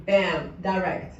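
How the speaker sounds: normal talking voice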